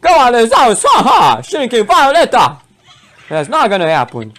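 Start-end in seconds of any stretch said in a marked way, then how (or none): 0.0-2.6 high, loud command with a laugh; triumphant, playful shout; battle call. Acted. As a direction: send your fighters in with gusto. Sending game characters into battle.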